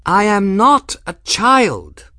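loud, high indignant protest. A narrator's reading. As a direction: stamp it out, offended girl.

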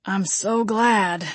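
slow, pained groan; mildly groaning, drawn-out delivery. A narrator's reading. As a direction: drag the words out through pain. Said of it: "Gabriel groaned".

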